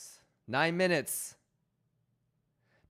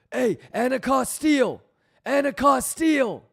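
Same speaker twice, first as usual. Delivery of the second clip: sing-song loud, higher callout of the name; mock call-out; 7 semitones higher, 7 dB louder than usual. Streamer recalling an inside joke, mock yell. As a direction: tease with a playful shout. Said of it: "giggling on the side talking about".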